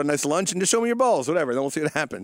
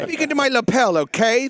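higher, louder comic impression of a fellow comic; Rich Voss voice; 6 semitones higher, 4 dB louder than usual. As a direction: do his pushy, raised comic voice. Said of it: "That's my Rich Voss".

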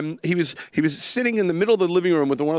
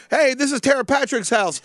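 higher-pitched, lightly acted party-busting cop; 7 semitones higher than usual. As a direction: announce it like a cop walking in. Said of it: "supposed to come in and be like".

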